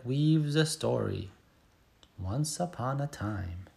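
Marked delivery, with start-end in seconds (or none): 2.2-3.7 hushed storytime. Pretend. Story opening in story.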